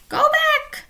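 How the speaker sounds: high, loud parrot squawk. A narrator's reading. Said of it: "she squawked".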